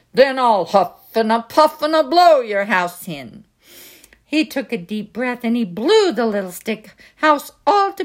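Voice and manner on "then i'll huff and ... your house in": louder, gruff big bad wolf growl. Wolf at the brick door, growling threat.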